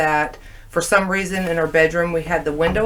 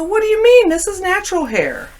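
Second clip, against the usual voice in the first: much higher, louder offended retort; indignant high surprise; 13 semitones higher, 4 dB louder than usual. Retold or imitated. Insulted by a stranger asking about her hair, high indignant surprise. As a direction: shoot back, insulted and amazed.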